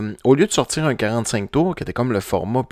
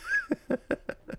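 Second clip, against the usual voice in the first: short high giggle, caught off guard; real laugh; 8 dB quieter than usual. Real, not acted. Host amused by the remix name, giggling. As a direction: burst out laughing at the title.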